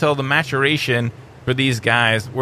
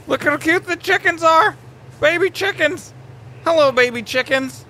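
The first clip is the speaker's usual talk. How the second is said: much higher, gentle cooing; 13 semitones higher than usual. Real, not acted. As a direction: coo to them like newborns. Cooing at baby dinosaurs.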